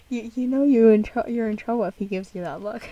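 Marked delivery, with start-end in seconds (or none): none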